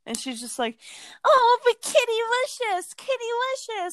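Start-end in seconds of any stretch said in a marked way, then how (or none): 1.2-3.9 very high squealing pet-talk voice; gushing aunt impression. Aunt doting on her cat, high gushing squeal. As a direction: mimic an aunt fawning over her cat in falsetto.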